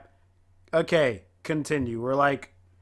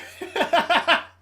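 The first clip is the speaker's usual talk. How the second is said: high laugh; 10 semitones higher than usual. Real, not acted. Reacting to gruesome story scene.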